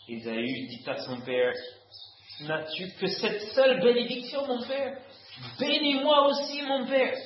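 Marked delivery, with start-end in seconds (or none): 2.5-5.0 tearful plea. A narrator's reading. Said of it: "Ésaü éleva la voix et pleura". 5.6-7.1 high, louder tearful cry; raised weeping plea. A narrator's reading. Esau begs his father again, raised tearful plea. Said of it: "Ésaü éleva la voix et pleura".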